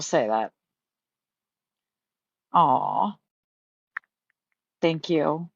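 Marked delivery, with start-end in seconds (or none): 2.5-3.2 tearful aw. Real, not acted. Moved by support, starting to cry.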